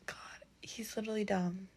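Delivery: soft exasperated whisper. Real, not acted. Vlogger confides to viewers that Cassian is dumb, whispering. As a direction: drop to a whisper to slag him off.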